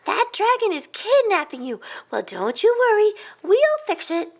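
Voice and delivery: high, forceful character voice; eagle to the rescue. A narrator's reading. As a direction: reassure loudly and take charge. Eagle vows to rescue Hamlet.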